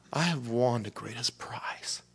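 slow, reverent whisper; whispered, slow and humbled; hushed whisper. Real, not acted. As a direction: breathe it like a private confession. Quiet confession of real reward.

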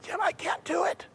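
strained, breathless squeak of a lost voice; barely gets the words out, voice gone. Retold or imitated. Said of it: "I lost my voice".

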